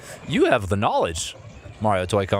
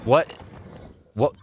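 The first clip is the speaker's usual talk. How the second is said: ordinary delivery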